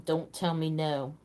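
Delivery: ordinary talk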